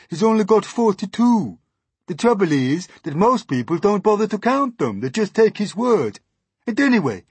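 high, loud, complaining earthworm voice; exasperated earthworm. Acted. Earthworm exposing centipede's lies about legs, exasperated. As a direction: complain loudly with swooping pitch.

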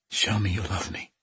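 soft, rough croak. A narrator's reading. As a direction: croak it out, barely voiced. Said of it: "she croaked".

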